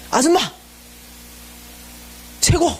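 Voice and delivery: high-pitched imitated vendor calling out praise; mock hearty shout. Tape seller praising the startled volunteer, hearty shout. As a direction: call out gruff praise. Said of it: "이러는 거예요".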